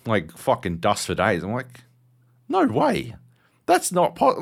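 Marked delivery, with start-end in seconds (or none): 2.5-4.4 high, incredulous gasp of denial; can't believe the dirt, pitched way up; mock disbelief. Shocked at the vacuum bag, high incredulous.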